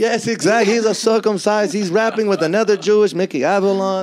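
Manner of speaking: high, loud put-on rabbi voice; imagined great-great-grandfather. Rabbi great-great-grandfather bragging about his descendant, high put-on voice. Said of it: "He might be like".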